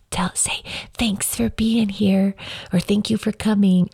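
hushed stage whisper prompt. Retold or imitated. Friend whispering a prompt to the boy on stage, hushed. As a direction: whisper a cue to a child. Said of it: "my friend runs up to him and says".

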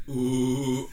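a light mock-seductive coo; seductive ooh. Friend imitating a seductive author's first word. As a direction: coo it like a woman in lingerie. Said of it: "the first thing that come out of her mouth would be".

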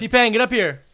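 mock summons, shouted high; calling out. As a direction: holler for him to come up. Calling imagined guest up from basement.

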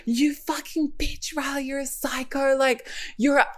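high, soft, slow condemnation; mock outraged sister. Sister calling Riley a psycho, mock outrage. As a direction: imitate an appalled sister in a high, small voice. Sister insulting her in shock.

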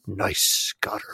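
sneering whispered troll reply; mocking whisper. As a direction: whisper it like a smug reply guy. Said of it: "a reply guy who's like".

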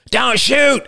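high-pitched warning cry; urgent call. A narrator's reading. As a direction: call out to stop the archers. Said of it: "called Roman".

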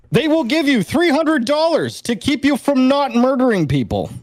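high, disbelieving emphasis; incredulous. Real, not acted. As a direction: sell the absurd punchline. Incredulous punchline.

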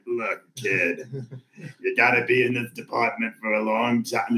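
lightly voiced New Orleans suburbs cop; koala voice. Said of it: "your koala voice".

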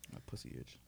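breathy whispered aside; whispering. Real, not acted. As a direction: whisper it under your breath. Silly aside near the mic.

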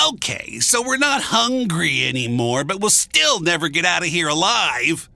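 complain, still upset; upset complaint. A narrator's reading. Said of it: "one of them was still pretty upset".

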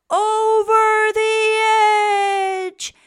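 very loud, high, slow call; dramatic cry. A narrator's reading. Narrator cries out the fall over the edge.

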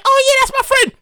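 high-pitched mock friend voice; friend's reply. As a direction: imitate the friend, pitched well up. Retold friend answers cheerfully.

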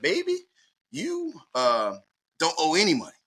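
put-on high-pitched Alabama accent, calling him baby. Bursar clerk tells the student he owes nothing, in a high Alabama accent. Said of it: "in her Alabama accent".

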